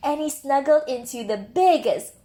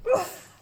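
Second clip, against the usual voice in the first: high strained oof; 11 semitones higher than usual. Acted. Hugging something heavy.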